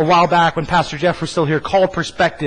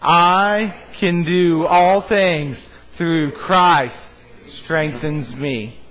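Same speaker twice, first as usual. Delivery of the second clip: extremely slow, every word held, fading at the end; mean every word, drag it out; said like you mean it; much slower than usual. Shown as a demonstration. Pastor urging the church to say it like they mean it, very slow. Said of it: "say it like you mean it".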